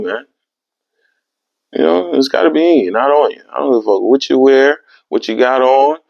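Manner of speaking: ordinary talk, nothing marked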